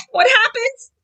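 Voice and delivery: usual speaking voice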